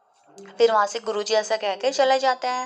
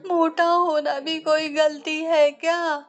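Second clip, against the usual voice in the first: protesting disciple; 6 semitones higher, slower than usual. Acted. Disciple protests being fat.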